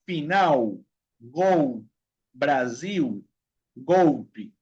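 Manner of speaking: final, gol, Brasil, golpe as "finau, gou, Braziu, goupi"; each of final, gol, Brasil, golpe apart, L rounded to u. Shown as a demonstration. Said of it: "se pronuncia la L como la U".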